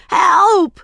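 high, loud drawn-out shout; shouted plea. A narrator's reading. Ramona sinking through lath, panicked shout. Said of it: "shouted Ramona".

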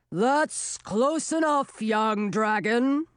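slow, booming king's voice, lightly voiced; a big dragon king booming out slowly; booming male voice. A narrator's reading. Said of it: "boomed a voice that seemed to make the walls shake".

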